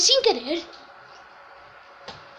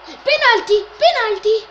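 loud penalty appeal; shouting; 5 semitones higher, 6 dB louder, slower than usual. Real, not acted. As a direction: yell it out as a protest. Claiming a penalty in game.

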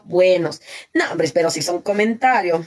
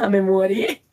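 laughing; slower than usual. Real, not acted. Laughing at comment.

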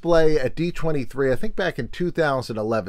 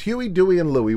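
ordinary delivery

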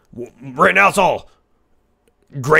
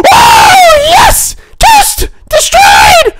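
very high, loud villainous cackling; maniacal screaming laughter; 10 dB louder, much slower than usual. Acted. Streamer gloating over destroyed toast, maniacal shrieking laugh. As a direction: shriek and cackle in mad triumph.

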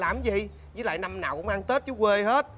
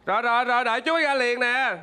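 raised, hurried reply from afar; calling back; 4 semitones higher, 5 dB louder than usual. Acted. Man answers the woman's call, calling back. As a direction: call back that you are coming.

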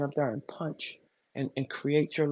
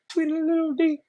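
mocking chirp; 12 semitones higher than usual. Mocking small birds chirping.

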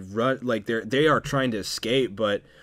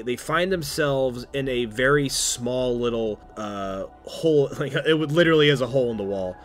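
ordinary delivery

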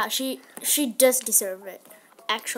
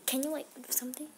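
whispering; 10 dB quieter, much faster than usual. Real, not acted. Whispered aside while recording.

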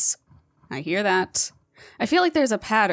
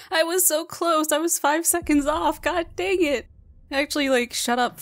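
high, pained lament; high frustrated whimper; disappointed whine; 8 semitones higher than usual. Real, not acted. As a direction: whine about the five seconds. Lamenting missed record.

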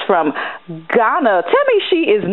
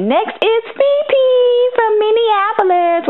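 hyped announcement; 12 semitones higher, slower than usual. Real, not acted. Hosts a lookbook roll call.

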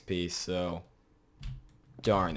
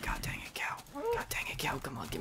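whispering; 3 semitones lower, 8 dB quieter than usual. Real, not acted. Whispered frustration.

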